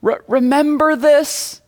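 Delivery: high-pitched wife, stretched out; lightly mocked high-voiced wife; imitating wife. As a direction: put on your wife's eager question, high and drawn out. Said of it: "she'll say".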